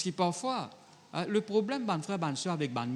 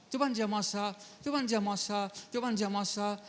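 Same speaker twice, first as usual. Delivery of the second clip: higher, insistent quoted retort; mock retort; 7 semitones higher than usual. Preacher voicing a wife's retort to her husband, higher. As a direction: throw the wife's complaint back.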